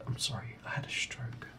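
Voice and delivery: embarrassed whispered aside; whispering. Real, not acted. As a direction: whisper the apology to yourself. Embarrassed aside after stumbling.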